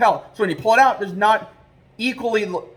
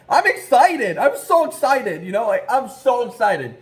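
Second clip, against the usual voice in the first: re-enacted excited outburst, higher at first; retold excitement; 3 semitones higher, slower than usual. Trapper answering his puzzled dad, acting out his excitement. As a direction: insist on it, drawn out and giddy. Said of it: "I was just super excited".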